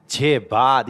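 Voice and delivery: normal talking voice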